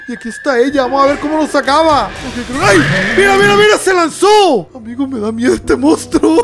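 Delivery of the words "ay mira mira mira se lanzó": excited shout. Real, not acted. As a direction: yell it as the monster jumps out. Monster suddenly leaps at camera.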